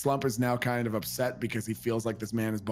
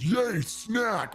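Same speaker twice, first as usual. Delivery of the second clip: gleeful cheer; 10 semitones higher than usual. Acted. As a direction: squeal it with childlike joy. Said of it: "Jake and Lou and goes".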